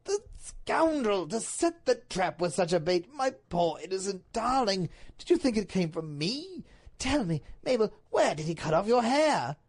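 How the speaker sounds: dramatic indignant Duke; outraged Duke. Acted. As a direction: denounce the villain, then press her urgently.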